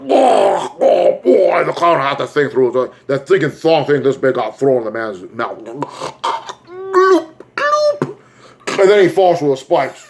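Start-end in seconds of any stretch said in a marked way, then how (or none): 0.1-1.6 high, hammed-up monster yelp; clown's roar. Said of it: "And the clown's like". 5.6-8.2 high-pitched cartoon gulps; gulping noise. Imitates swallowing the thrown weapon.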